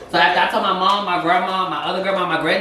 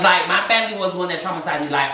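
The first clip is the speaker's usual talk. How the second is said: normal talking voice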